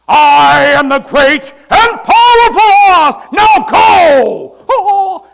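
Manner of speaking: booming Oz voice. Retold or imitated. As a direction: thunder it out like the great Oz. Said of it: "the wizard is in there".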